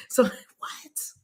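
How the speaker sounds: laughing whisper. Real, not acted. A laughing whispered aside to viewers.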